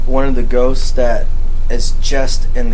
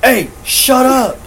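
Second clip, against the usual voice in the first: mock yell; 11 semitones higher, slower than usual. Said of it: "He says".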